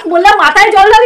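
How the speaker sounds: ordinary talk, nothing marked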